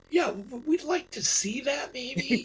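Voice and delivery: high-pitched, tentative mock politeness; retold coy request. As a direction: ask high and lightly, played for a laugh. Retold eager request to see video.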